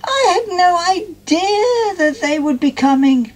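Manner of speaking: high, thrilled squeal; excited squeal. A narrator's reading. Molly hearing the king and queen will come, squealing. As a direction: gush it out in delight. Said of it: "Molly squealed with excitement".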